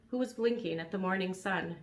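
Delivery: plain reading voice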